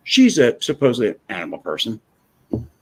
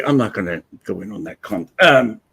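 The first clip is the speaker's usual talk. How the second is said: usual speaking voice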